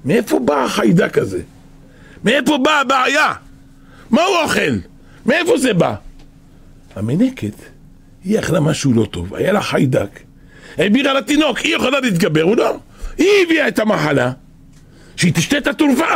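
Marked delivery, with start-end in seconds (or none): 0.1-6.0 heated courtroom argument. Acted. Lawyer argues before the judge. 10.8-16.0 heated courtroom argument. Acted. Lawyer's conclusion in court.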